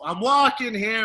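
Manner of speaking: lightly hollered imitation; playful catchphrase impression. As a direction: toss out the cartoon uncle's line. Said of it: "just randomly, I'll go".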